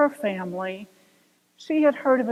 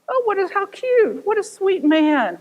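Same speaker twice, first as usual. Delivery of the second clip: higher, cooing delight; gushing; 7 semitones higher, 3 dB louder than usual. Retold or imitated. Audience gushing over grandbaby, cooing. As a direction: coo over it.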